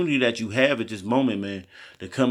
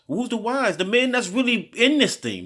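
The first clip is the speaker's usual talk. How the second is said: pitched way up, pressing the question; preaching high; 12 semitones higher than usual. Real, not acted. Preacher lifting his voice to stir the listeners. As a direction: rise high to drive home who's wise. Preaching, asks who's the wise.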